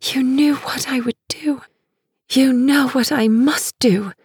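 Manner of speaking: high, hushed whisper. Acted. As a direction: keep whispering, urgent. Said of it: "she whispers".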